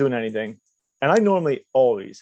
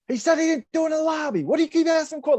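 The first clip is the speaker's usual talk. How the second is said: high-pitched mock yell, no great volume; imitated yelling. Stepdad yelling at the judge in court, mock high-pitched yell. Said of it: "My stepdad just starts yelling".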